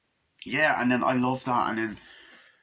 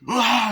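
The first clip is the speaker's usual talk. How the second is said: high loud scream; 12 semitones higher, 9 dB louder than usual. Acted. Reviewer acts out a scream.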